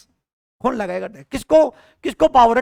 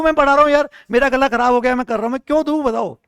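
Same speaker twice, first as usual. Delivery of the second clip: acted indignant outburst, higher and louder; mock-protest loudly like an aggrieved taxpayer; 4 semitones higher, 4 dB louder, faster than usual. Teacher playing a working man refusing to pay tax, indignant protest.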